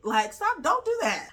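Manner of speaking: loud plea jumping high on "don't"; exasperated scolding. Real, not acted. Host scolds men acting tough, exasperated high plea. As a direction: cut off the nonsense, pitch shooting up.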